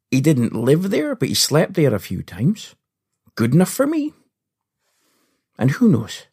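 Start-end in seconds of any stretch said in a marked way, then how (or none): none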